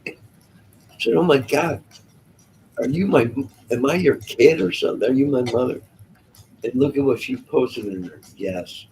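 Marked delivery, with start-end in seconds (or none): 1.1-5.8 mock alarm. Retells his alarmed reply to her.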